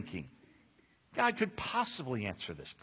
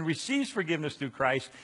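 normal talking voice

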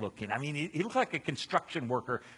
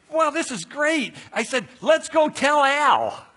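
eager; 11 semitones higher, 10 dB louder, slower than usual. Retold or imitated. Excited new believer plans to tell Al.

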